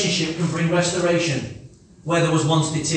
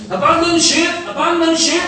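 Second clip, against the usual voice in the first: mock alarm cry, higher and louder; shouting abandon ship; 9 semitones higher, 7 dB louder than usual. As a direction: yell it like a sinking ship alarm. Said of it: "that's my emergency switch".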